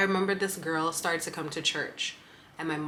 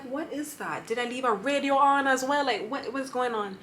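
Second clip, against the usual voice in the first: higher-pitched mock bewilderment; baffled mom; 6 semitones higher than usual. Retelling mom sitting up at the noise, lightly acted bafflement. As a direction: imitate a confused mother, voice lifted throughout. Mom sits up confused, voiced.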